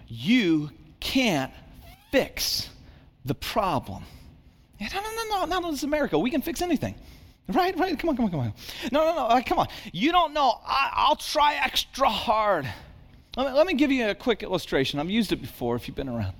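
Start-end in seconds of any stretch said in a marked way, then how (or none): 4.8-14.4 high, mocking voice of a stubborn listener; mock protest. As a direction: play the scoffing crowd who insists they can fix it. Mocking self-reliant American attitude.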